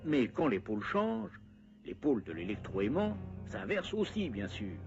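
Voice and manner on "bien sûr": normal talking voice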